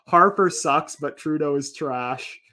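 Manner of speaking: Canadian accent. Shown as a demonstration. Reading meme slogans, slow Canadian accent. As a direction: draw each word out in Canadian. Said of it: "do the whole meme in your accent".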